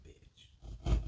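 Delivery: 속삭이듯 경멸; breathy voiceless whisper, barely audible; contemptuous whisper. Retold or imitated. Retold contemptuous muttered aside.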